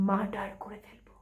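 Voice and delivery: menacing whisper; whispered threat. Acted. Jealous bride-to-be vows to murder rivals, whispering. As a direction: whisper it as a deadly promise.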